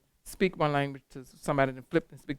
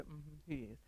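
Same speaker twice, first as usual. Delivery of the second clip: quiet confiding murmur, much softer; gossipy hush, much softer; hushed gossip; 21 dB quieter, slower than usual. Retold or imitated. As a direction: pass it on like a secret. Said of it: "Cheryl can go to Kim and say".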